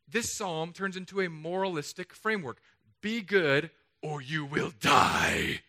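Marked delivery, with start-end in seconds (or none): 3.0-5.6 slow booming preacher shout; fire and brimstone preacher. Shown as a demonstration. Pastor mocking a moralizing preacher, slow booming shout. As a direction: thunder it like a hellfire preacher. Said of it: "I could do that voice".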